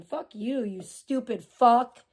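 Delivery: normal talking voice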